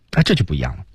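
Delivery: normal talking voice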